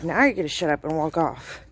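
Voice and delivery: usual speaking voice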